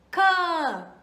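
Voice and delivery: loud, high, drawn-out nasal "Quand" with silent d; model pronunciation. Shown as a demonstration. Teacher asking learners to repeat along, loud drawn-out model "Quand". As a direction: punch and stretch "Quand" for learners to copy. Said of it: "Repete aí comigo".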